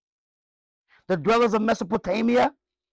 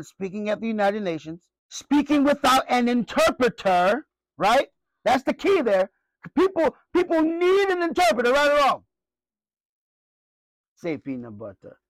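ordinary delivery

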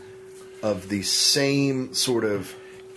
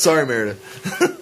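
normal talking voice

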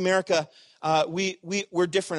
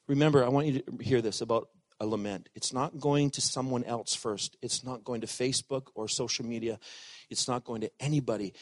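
ordinary talk, nothing marked

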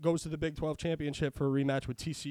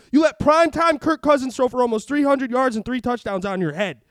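louder, heated listing of quarterbacks; angry rant; 4 semitones higher, 7 dB louder than usual. Real, not acted. Ranting to his co-host about the Patriots defense, angry and loud. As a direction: rail against the overrated defense. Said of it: "Rant over".

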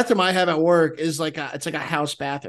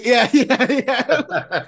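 laughing; 6 semitones higher, 4 dB louder, much slower than usual. Real, not acted. Laughs heard.

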